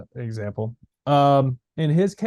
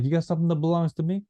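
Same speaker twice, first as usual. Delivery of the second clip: quick, higher mock Italian-American mobster accent; 4 semitones higher, faster than usual. Playing a mobster demanding his property back, mock Italian mobster accent. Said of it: "it's like Italian mobster accent".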